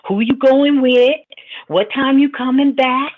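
hammed-up worried mother grilling her grown daughter; nervous mama. Mother asks Sky where she is going, loud and anxious. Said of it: "They like".

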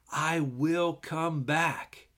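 I will come back drawn out slowly; slow and weighty. Shown as a demonstration. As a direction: let the promise hang. Reading the verse.

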